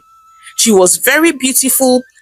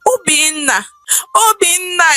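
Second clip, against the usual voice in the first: calling a name, rising higher each time; 6 semitones higher than usual. Acted. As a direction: call him again and again, more urgent. Mother calling son repeatedly.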